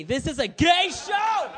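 high, exasperated shout; mock scolding. Comic scolding a straight-looking guy at the show, mock-outraged shout. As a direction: holler it in mock outrage, drawing it out.

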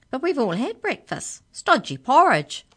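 high-pitched, exaggerated canine character voice; canine leader. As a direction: swoop high, growing loud on the complaint. Canine leader explains breakfast.

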